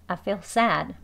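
quick natural feel; relaxed everyday sentence; casual reading. Shown as a demonstration. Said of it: "feel, feel 하고 feel".

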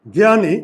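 জ্ঞানী said as গ্যানি with short i; pronounced gyani. Shown as a demonstration. Teacher repeating জ্ঞানী as গ্যানি for class. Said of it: "খেয়াল করো".

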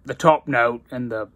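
talking around pipe stem; pipe in mouth. Real, not acted. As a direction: lips clenched on stem. Seen 2: Pipe stem sits at the mouth corner, held, while talking.

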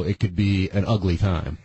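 normal talking voice

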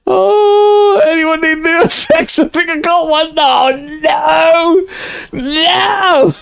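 very high squealed laugh-talk; helpless laughing wail. Real, not acted. Mortified streamer reacting to the clip, wailing with laughter. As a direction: squeal it out in hysterics.

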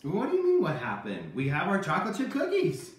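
ordinary talk

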